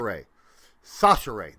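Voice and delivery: Sasheri as "SAH-shuh-ray", repeated firmly; confirmed pronunciation. Shown as a demonstration. Host confirming a player's name to co-host, firm SAH-shuh-ray. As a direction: punch the first syllable of Sasheri, end in "ray". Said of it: "That's how it's pronounced".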